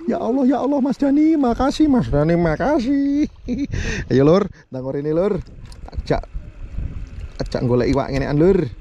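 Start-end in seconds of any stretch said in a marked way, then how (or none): 0.1-3.3 delighted thanks. Real, not acted. Happy catch, thanking Mas Dani.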